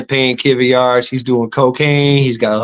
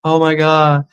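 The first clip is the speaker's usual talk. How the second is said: mock amazement; 4 semitones higher, much slower than usual. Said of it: "you're like".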